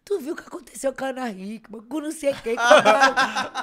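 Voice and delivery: high, loud retold wail breaking into laughter; mock crying. Mother phoning her daughter in tears, mock crying. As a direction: imitate a tearful phone call, laughing through it.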